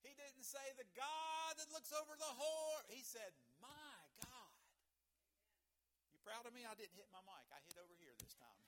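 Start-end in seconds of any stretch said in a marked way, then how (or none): 0.0-4.5 high, loud build with "God" held long and belted; full-force pulpit cry; ear-hurting preacher shout. Real, not acted. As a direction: thunder each contrast, stretching "God". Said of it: "I didn't want to hurt your ears".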